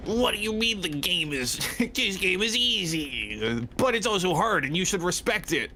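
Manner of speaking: mocking gamer voice. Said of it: "they're like".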